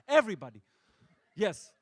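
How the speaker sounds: shouted punchline; loud. Acted. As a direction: punch the word out.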